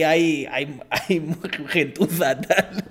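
laughing. Retold or imitated.

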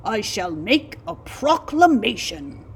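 loud, booming royal decree; heroic regal voice. A narrator's reading. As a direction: proclaim like a warrior king. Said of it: "he said in a heroic, regal voice".

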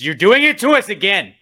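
loud, rising wail of exasperation; fed-up fan's loud protest; exasperated outburst. Real, not acted. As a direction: complain at full volume, pitch climbing. Ranting at his team.

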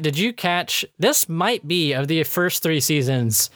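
usual speaking voice

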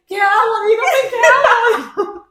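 high, loud, laughing imitation of panic; drawn-out panicky begging, played for laughs; mock scared pleading. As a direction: plead like a terrified friend, hammed up. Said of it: "estaba cagadísimo".

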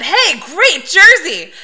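high, loud, drawn-out mock greeting; enthusiastic greeting. Front desk guy greets a fan in jersey, mock enthusiastic. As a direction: imitate a clerk hailing a guest, stretched and high.

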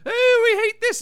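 high, loud imitation of someone fed up; mock exasperated outburst. Daphne's fed-up outburst, acted.

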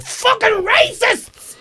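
high-pitched, drawn-out yell; shrieky re-enacted heckle; shout. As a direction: holler it out of a car window. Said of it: "roll my window down the car and I shout".

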